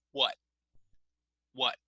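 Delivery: WHAT cut short on an unreleased T; stop on the T. Shown as a demonstration. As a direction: say "What" and hold the t without releasing it. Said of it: "We stop on the T".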